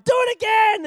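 put-on high, loud shouted chant from the kids; kids yelling. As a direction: shout it like a hyped crowd of children. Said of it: "they're like".

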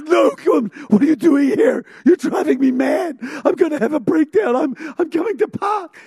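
high, loud, desperate outburst; frantic breakdown. Acted. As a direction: cry out like someone at breaking point. Acted judge breaking down at her return.

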